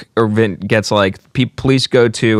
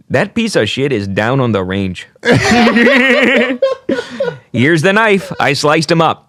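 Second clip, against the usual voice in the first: put-on Canadian accent; 6 semitones higher than usual. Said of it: "I gotta do it in a Canadian accent".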